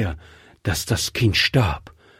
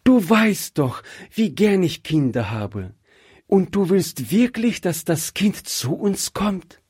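high, thrilled cries of his name; overjoyed realization, much higher; excited; 10 semitones higher than usual. Acted. Wife realizes husband wants the orphan, excited and much higher. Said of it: "Rebekka war ganz aufgeregt".

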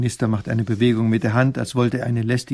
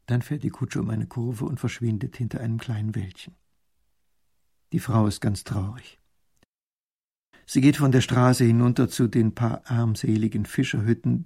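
ordinary delivery

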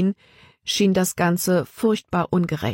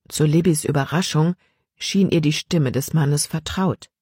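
usual speaking voice

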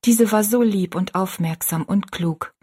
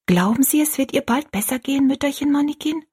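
put-on high, rising child's question; hopeful little girl; 8 semitones higher than usual. A narrator's reading. Rosalie asks whether mother will recover, high and hopeful.

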